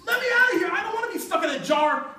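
high, loud whiny plea; frantic goblin. Acted. Trapped goblin begs Herschel, high and loud. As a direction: beg to be let out.

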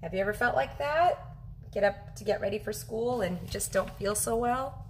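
ordinary delivery

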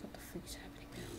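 very soft, voiceless whisper; whispered bewilderment. Acted. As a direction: whisper it as the confused character. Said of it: "So he's like".

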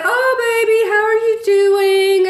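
high, sweet imitation of a loving mom; sweet motherly greeting. Terry's mom on the car speaker to her daughter, high sweet coo. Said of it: "her mom was so sweet to her".

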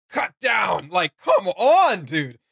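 loud, high, dragged-out hype over a cool line; hyped up. Real, not acted. Gamer raving to his co-host about a voice line, loud hype. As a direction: gush about how cool it was, drawing out the words.

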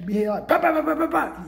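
rising bah bah gunshot noises; playful imitated shooting; mock gunfire. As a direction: spit out the bahs like a rifle.

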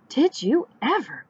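a touch of shocked disbelief; gasped astonishment. A narrator's reading. Grandma seeing Duck on a tractor, gasping. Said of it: "Grandma gasped".

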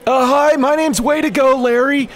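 high, loud silly guy; squeaky nervous customer; high goofy customer voice. Pretend.

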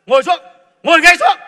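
stern order. Acted. Retired boss loudly ordering the young man to sit down. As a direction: bark it like an angry boss.

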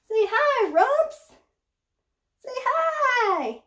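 high, sing-song voice to a pet; cooing at her snake; pet talk. Real, not acted. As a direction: coo at the snake to wave hello.

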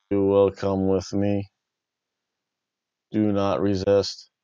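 slow, monotone robot-cop voice; low, measured commands like a machine cop; RoboCop. Acted. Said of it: "I think, RoboCop".

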